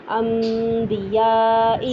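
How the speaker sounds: انبیاء drawn out, noon turned to m with nasal hum; slow recited انبیاء with nasal ghunna; iqlab with ghunna. Shown as a demonstration. Teacher demonstrating iqlab to students. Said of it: "جیسے انبیاء والا".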